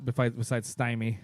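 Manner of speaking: ordinary talk, nothing marked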